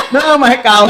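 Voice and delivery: laughing. Real, not acted. Laughing while retelling chase.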